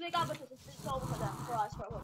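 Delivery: usual speaking voice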